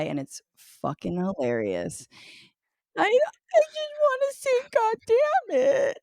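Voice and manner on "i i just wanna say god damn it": very high, whining mock wail; toddler fake cry. Mom imitating her toddler who wants to curse, fake crying. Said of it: "she'll just fake cry".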